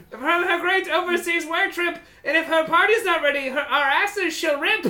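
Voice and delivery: high sing-song kobold rhyme; rhyming kobold boss. Acted. Kobold warns about the unready party, high rhyming. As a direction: declaim the rhyme in a squeaky voice.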